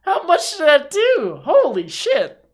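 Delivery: high, loud shocked cry; shocked outburst. Real, not acted. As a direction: cry out in disbelief. Huge damage taken, disbelief.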